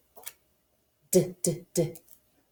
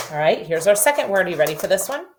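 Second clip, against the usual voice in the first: normal talking voice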